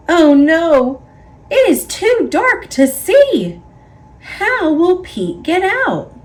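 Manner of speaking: hammed-up fright for young listeners; worried storyteller. A narrator's reading. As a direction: build the suspense with a gasp of worry. Trapped in the dark cave.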